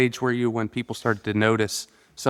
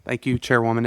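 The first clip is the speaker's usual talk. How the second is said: ordinary delivery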